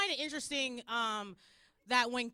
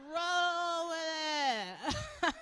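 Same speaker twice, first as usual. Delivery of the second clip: high, very slow stretched call; drawn-out cheer; 4 semitones higher, 4 dB louder, much slower than usual. Acted. Mother urging fallen son to roll, drawn-out cheer.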